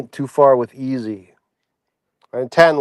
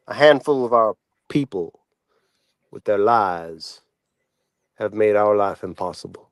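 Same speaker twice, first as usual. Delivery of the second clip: low, grave oratory with long pauses; recited famous speech; 6 semitones lower than usual. Acted. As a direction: deliver a solemn address, phrase by phrase.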